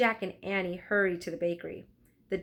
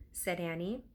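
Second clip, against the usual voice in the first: ordinary delivery, nothing marked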